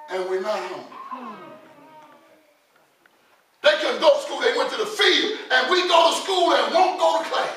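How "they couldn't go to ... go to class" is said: full-force preaching. Acted. Preacher rebuking those who forget God, full-force preaching. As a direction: drive it home to the congregation, loudly.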